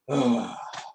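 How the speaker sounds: long audible exhale; exhale demo. Shown as a demonstration. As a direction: breathe out slowly with a sigh. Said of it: "you will do this". (mahabang buga ng hininga)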